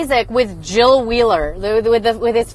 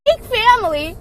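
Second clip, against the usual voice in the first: mocking emphasis; 10 semitones higher than usual. Shown as a demonstration.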